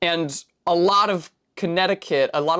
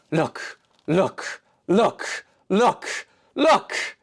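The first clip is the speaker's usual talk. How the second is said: a hint of a child rising higher each time; playful urging; 5 semitones higher, much slower than usual. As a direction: coax them to look, ending on a high squeal. Urging viewer to look.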